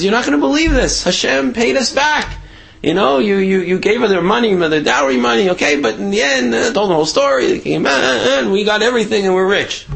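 high-pitched, excited imitation of a wife; happy wife. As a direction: gush the good news to a husband. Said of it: "she's happy and smiling".

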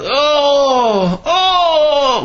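loud high groan; loud groaning cry. Retold or imitated. Innkeeper groaning in the night, loud and high. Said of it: "like, really, like, serious".

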